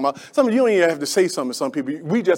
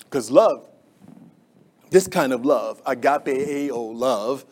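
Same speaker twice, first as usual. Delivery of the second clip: soft, lower, slow reverent tone; tender closing; 5 semitones lower, 3 dB quieter, slower than usual. Real, not acted. Preacher gently explaining agape love. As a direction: ease down into sincerity. Sermon drops to tender close.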